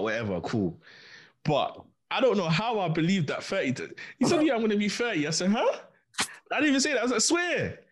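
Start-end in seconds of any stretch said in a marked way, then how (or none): 1.4-7.8 pitched way up in disbelief; incredulous. Retold or imitated. Host retells being fooled about age, incredulous. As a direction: replay the shock of the lie.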